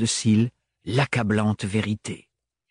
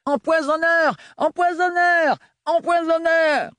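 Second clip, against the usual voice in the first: much higher, slow repeated cries; echoing shouts; 3 dB louder than usual. Acted. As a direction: chant the accusation. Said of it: "une explosion d'échos".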